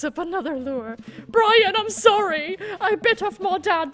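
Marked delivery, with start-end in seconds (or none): 1.3-3.9 mock apology. Loud exaggerated apology to Brian.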